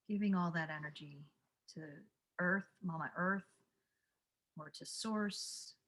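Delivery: guided meditation. Acted. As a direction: guide them calmly into the breath. Leads a breathing calm-down.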